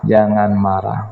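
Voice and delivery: usual speaking voice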